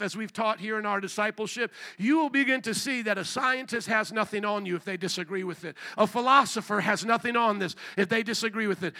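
ordinary delivery

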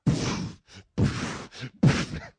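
big unvoiced burst of laughter; loud breathy laugh. Real, not acted. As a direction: laugh out hard. Laughing at the chaos.

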